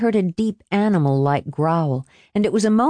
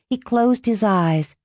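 ordinary delivery

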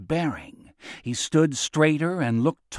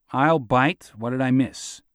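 plain reading voice

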